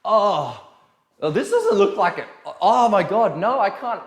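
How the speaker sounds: mock horror. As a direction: ham up mirror panic. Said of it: "they go to the mirror and they're like".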